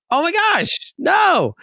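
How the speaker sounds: high squealing oh my gosh; mock surprise. Greeting his ex at the restaurant, high mock surprise. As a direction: fake delight, pitch way up. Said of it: "I'm not saying that".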